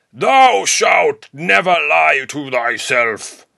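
slow booming prophet voice; biblical prophet voice. Pretend. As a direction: proclaim it like scripture. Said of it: "I'll keep the biblical tone for dramatic effect".

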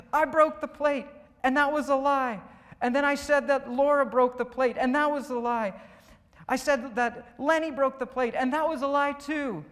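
high, loud, worked-up cartoon confession; Junior. Pastor voicing cartoon Junior confessing lies, worked up. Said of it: "worked up".

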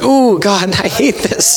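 high-pitched wail of mock anguish; pained complaint. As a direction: groan it out to God, pitched high. Voicing own reaction to conviction.

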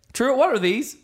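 mock-innocent parental question; playing dumb. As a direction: ask as if you don't already know. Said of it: "you play dumb".

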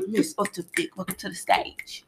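hushed mock stage introduction; open-mic host. Co-host introducing the poet to the stage, hushed mock emcee. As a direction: softly announce the next poet.

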